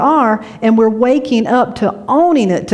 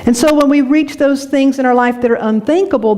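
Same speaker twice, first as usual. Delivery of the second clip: normal talking voice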